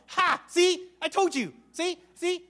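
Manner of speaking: high, loud mocking crow; smug doctor crowing over a failed prayer; gloating doctor. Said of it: "he came out".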